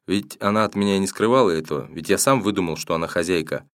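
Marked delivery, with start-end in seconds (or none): none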